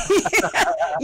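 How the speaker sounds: high burst of real laughter; amused laugh. Real, not acted. Host laughs at an interrupted sign-off.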